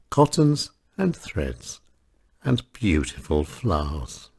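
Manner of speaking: plain reading voice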